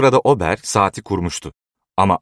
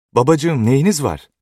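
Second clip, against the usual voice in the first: higher-pitched, anxious question; alarmed daughter; 7 semitones higher than usual. A narrator's reading. Daughter to collapsed father, alarmed and high. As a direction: ask urgently what has happened to him.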